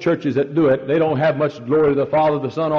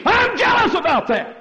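much higher and louder cry; jealous Lord; 5 dB louder than usual. Acted. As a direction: burst out in godly jealousy. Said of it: "the Lord looks down and says".

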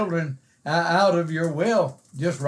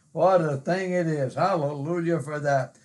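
ordinary talk

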